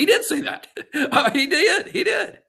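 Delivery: high, laughing through the words; cracking up while insisting. Real, not acted. Host laughing telling cohost about the quarterback, laughing.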